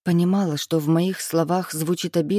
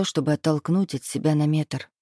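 ordinary delivery

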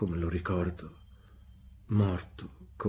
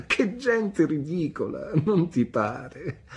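high, drawn-out amused exclamation; amused, laughing; 7 semitones higher, slower than usual. A narrator's reading. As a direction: exclaim slowly, up in pitch, amused. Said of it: "ridendo e coprendosi il volto". (esclamazione divertita, lenta e acuta)

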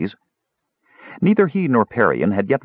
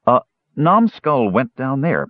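plain reading voice

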